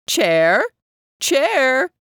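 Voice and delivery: loud calling, rising. A narrator's reading. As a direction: call across the room. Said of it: "She called out".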